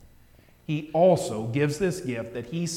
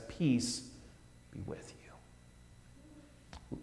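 fading into a slow whisper; hushed blessing; 2 semitones higher, 13 dB quieter, much slower than usual. Retold or imitated. Jesus to his disciples, whispered and slow. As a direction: let it drift away into a breath. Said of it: "he is the one who says".

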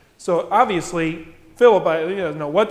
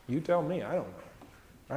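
much softer, slow, trailing off; helpless; 4 semitones lower, 9 dB quieter, slower than usual. Retold or imitated. Philip answering Jesus, lost, fading to near nothing. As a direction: fade out lost and at a loss.

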